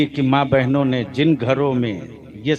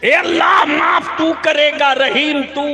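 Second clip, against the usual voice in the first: preaching at full force; 11 semitones higher, 7 dB louder than usual. Real, not acted. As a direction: call out to the crowd, soaring. Preacher's prayer call. (पूरे जोश से तक़रीर)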